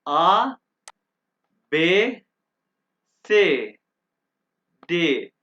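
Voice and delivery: clear, loud German letter names A, B, C, D; teacher spelling out the German alphabet. Shown as a demonstration. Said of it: "अब आप सुने".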